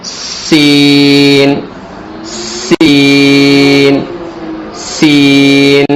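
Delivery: سین drawn out with a long hissing whistle; whistling s. Shown as a demonstration. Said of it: "سیٹی کی آواز پیدا کی جائے گی".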